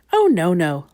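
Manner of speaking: ordinary delivery, nothing marked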